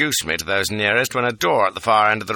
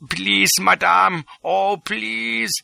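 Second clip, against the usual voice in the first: 1 begging; 10 semitones higher, much slower than usual. A narrator's reading. Chap near tears.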